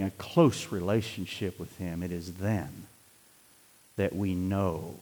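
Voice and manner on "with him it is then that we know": low, soft, near-whispered conviction; hushed sermon. Acted. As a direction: lean in and hush it to them. Drops to intimate hush.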